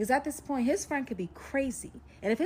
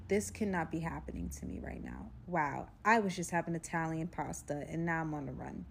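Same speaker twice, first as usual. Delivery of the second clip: low, soft, subdued inner monologue; quietly stunned, held-in calm; keeping calm; 6 semitones lower, 6 dB quieter than usual. Retold or imitated. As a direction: murmur it to yourself in contained disbelief. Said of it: "I'm just keeping myself calm".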